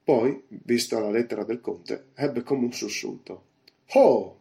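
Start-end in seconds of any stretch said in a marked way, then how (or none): 3.9-4.4 loud exclamation. A narrator's reading. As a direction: burst out with a sudden loud 'oh'. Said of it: "disse a voce alta".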